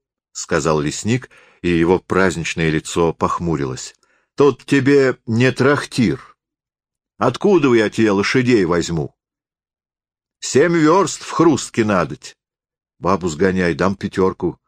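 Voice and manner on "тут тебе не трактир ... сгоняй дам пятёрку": voiced as a gruff-sounding forester, pitched up; peasant forester. A narrator's reading. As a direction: warn a guest off in his own house.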